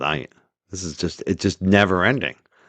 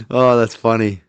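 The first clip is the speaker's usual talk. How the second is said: usual speaking voice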